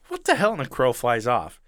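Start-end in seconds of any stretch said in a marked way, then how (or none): 0.1-0.5 much higher startled outburst; a jolt of disbelief; what the hell, up high in shock. Retold or imitated. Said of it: "he's just like".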